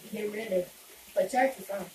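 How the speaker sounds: usual speaking voice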